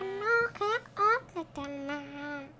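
high, stretched mimicry of a friend; boy mocking his friend's words; mocking imitation. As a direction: parrot him in a silly voice. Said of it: "mocks S2's line".

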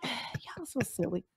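slow exasperated whisper. Real, not acted. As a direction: breathe it out under your breath. Exasperated aside after a sigh.

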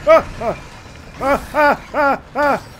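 rapid high, loud yelps; string of short panicked cries; startled screams. Real, not acted. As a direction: yelp over and over in fright. Startled in game.

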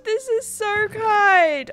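high, drawn-out grateful squeal; overwhelmed by kindness; touched. Real, not acted. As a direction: gush slowly, pitched right up. Overwhelmed by a kind gift.

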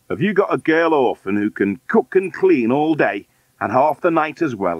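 lightly voiced demanding man; demanding man on phone.

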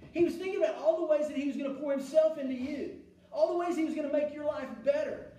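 loud, driving sermon delivery; full-force preaching. Acted. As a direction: preach it hard to the congregation.

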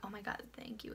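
breathy unvoiced thank-you; relieved whisper. Girls watching the stranger walk away, whispering relief. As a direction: sigh it out as a whisper.

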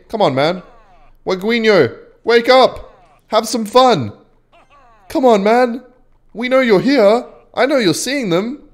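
high-pitched, pestering coaxing; goading call. Real, not acted. Player prods silent opponent to emote back, goading call. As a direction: call out to a rival who ignores you.

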